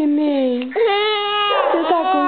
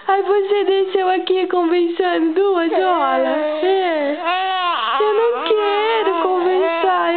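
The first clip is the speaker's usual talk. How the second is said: higher, whiny crying complaint; cranky baby voice; 4 semitones higher than usual. Pretend. Mother speaking as her baby who wants to sleep, higher whiny crying. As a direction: complain like a tired toddler on the edge of tears.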